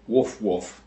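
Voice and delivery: two short mock barks, woof woof; dog bark imitation. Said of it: "barks twice".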